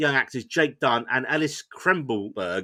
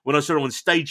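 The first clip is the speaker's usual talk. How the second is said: ordinary talk, nothing marked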